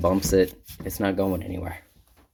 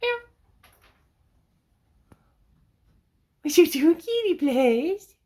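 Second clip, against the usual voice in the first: very high, slow sing-song pet voice. Real, not acted. Talking to Kitty Blaze in the loft, high cooing pet voice. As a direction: coo sweetly up at the kitty. Baby talk to the cat.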